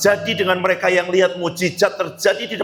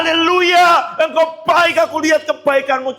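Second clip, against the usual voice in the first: full-throated hallelujah, much higher and louder; shouted praise; 10 semitones higher, 5 dB louder than usual. Shown as a demonstration. Preacher showing disciples' joyful praise, loud excited shout. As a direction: shout it out like a freed prisoner. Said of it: "Dia teriak".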